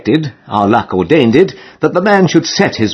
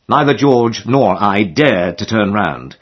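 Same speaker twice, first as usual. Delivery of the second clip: ordinary delivery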